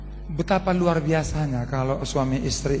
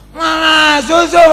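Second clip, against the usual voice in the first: put-on high, slow wailing cry of a child; 13 semitones higher, 12 dB louder, much slower than usual. Preacher mimics his son crying for milk at night, high slow wail. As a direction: wail it like a toddler wanting milk.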